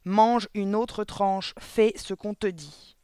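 loud, slow, insistent command; stern order. A narrator's reading. Headmistress orders the boy to eat another slice, stern and slow. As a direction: spell the order out slowly and forcefully.

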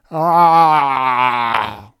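long, very loud scream; hurlement. A narrator's reading. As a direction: scream out in agony, falling away. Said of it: "Le capitaine Vincent hurla". (long hurlement de douleur)

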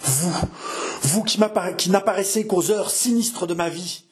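high-pitched, appalled accusation; dramatic confrontation. Acted. Mother facing the sinister Henri, dramatic confrontation.

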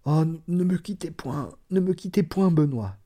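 woman in labour panting to Benoît not to leave; panting plea. A narrator's reading. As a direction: gasp it out, begging him to stay. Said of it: "Elle répondit en haletant".

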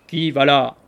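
big rough threatening bark; gruff terrible voice. A narrator's reading. Ruffian at the window challenging the knocker, gruff bark. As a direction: boom like a bearded thug. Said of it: "d'une grosse voix, rauque et terrible".

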